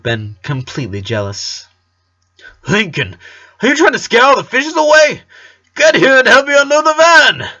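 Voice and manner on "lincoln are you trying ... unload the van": high, loud calling father voice; a dad yelling from the van. A narrator's reading. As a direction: shout across the lake to the boy. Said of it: "as his father told him".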